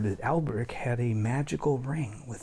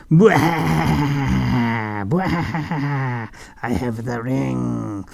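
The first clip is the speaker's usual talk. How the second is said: villain laugh; 2 semitones higher, 6 dB louder, much slower than usual. Acted. Alberic gloating villain.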